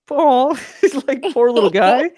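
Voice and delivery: high-pitched cooing sympathy; pitying coo. Retold or imitated. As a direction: coo with pity, a laugh breaking in. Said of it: "And I was like".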